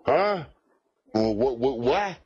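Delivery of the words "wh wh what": usual speaking voice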